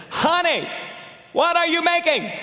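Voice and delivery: very high, pushed call right up close; in her face. Retold or imitated. Husband asks right in his wife's face, very high call. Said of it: "gets right in her face and says".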